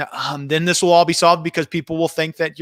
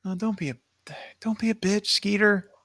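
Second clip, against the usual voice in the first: hissed whisper; harsh whisper; 3 semitones higher, 7 dB quieter than usual. Acted. Host scolding crying Skeeter in a harsh whisper. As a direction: scold him under your breath.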